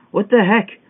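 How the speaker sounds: high, loud exasperated yell at the game; frustrated yell. Real, not acted. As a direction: shout it out in disbelief. Falls again in parkour game.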